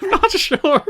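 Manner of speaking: high, loud laughing through the words. Real, not acted. Host unsure of own notes, cracking up laughing. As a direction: break up mid-sentence, voice shooting up. Breaks up laughing while admitting doubt.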